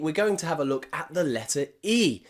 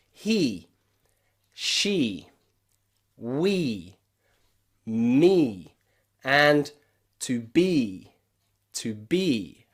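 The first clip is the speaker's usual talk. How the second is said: one word at a time, long pauses between; slow practice drill; 3 semitones lower, much slower than usual. Shown as a demonstration. Teacher asks learners to practice with her words, slow drill. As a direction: draw out each "ee" for repeating. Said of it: "Practice with me".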